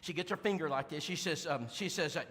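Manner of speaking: ordinary talk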